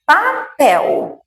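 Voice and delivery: "papel" in two beats, pá high, pel falling; separado em sílabas. Shown as a demonstration. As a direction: split "papel" clearly into pa-pel. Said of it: "tá faltando aqui o pel". ("papel" sílaba por sílaba)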